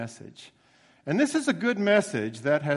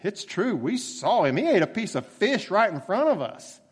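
eager, raised voice of the disciples; excited; 7 semitones higher, 4 dB louder than usual. Acted. Disciples telling Thomas, excited and raised. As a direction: tell it like amazed eyewitnesses.

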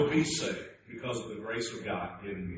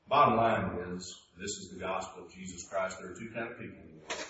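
normal talking voice

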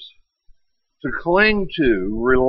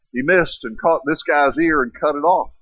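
ordinary talk

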